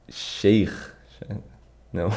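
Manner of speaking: usual speaking voice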